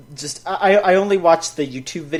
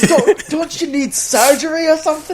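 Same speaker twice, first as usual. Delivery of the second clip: much higher, exaggerated Aussie voice; squeaky cartoon Australian asking in concern; mock Australian accent; 12 semitones higher, 4 dB louder than usual. Joining the mock Australian bit.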